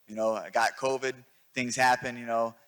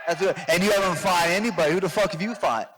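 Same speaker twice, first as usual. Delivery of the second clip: raised voice, taunting back; gruff punchy boasting; 4 semitones higher than usual. Real, not acted. Heated trash talk back.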